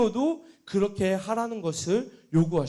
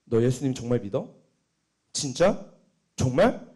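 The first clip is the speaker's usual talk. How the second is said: repeated probing questions, rising at the end; pressing questioning; 7 semitones lower than usual. Retold or imitated. Someone testing another's faith, mildly insistent. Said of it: "이 정말을 여러 번 물어보면".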